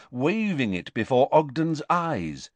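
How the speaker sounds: ordinary delivery, nothing marked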